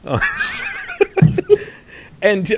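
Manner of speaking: normal talking voice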